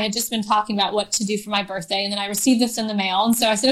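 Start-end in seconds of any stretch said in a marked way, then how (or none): none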